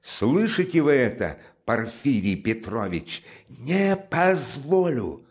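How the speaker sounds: high, loud, slow furious cry; furious shout. Acted. Raskolnikov shouts at Porfiry in fury. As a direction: shout him down with rage. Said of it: "крикнул он вдруг".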